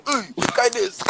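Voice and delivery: high, astonished exclamation; as if spotting something unbelievable; retold amazement. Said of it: "I say".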